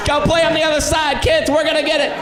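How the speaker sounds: high-pitched dad calling across the pool. Retold or imitated. Dad fishing out pool mess to kids, calling out. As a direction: holler to the kids, hammed up.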